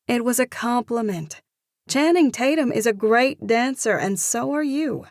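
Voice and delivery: excited. Acted. Heroine voice, raised.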